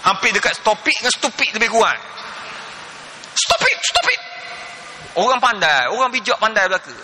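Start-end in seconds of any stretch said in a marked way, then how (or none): 3.3-4.2 very high, squeaky mock telling-off; imitating scolding wife. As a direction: shrilly mimic a wife cutting her husband off. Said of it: "Marah bini dia".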